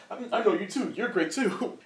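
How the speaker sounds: high nervous gush; flustered fanboy. Retold or imitated. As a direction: blurt compliments while flustered. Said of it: "is like".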